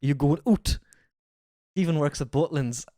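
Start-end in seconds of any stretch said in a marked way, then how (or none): 0.0-0.8 a light Geordie "Are you going out?". Shown as a demonstration. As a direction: lean back into Geordie. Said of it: "I'm back into Geordie".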